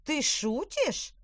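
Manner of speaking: slow stunned question; disbelieving. A narrator's reading. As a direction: ask it slowly, stunned. Witch voice.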